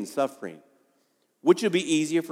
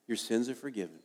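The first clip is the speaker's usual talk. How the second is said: softer echo of sins are forgiven; hushed repeat; 4 semitones lower, 11 dB quieter than usual. Shown as a demonstration. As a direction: let it sink in quietly.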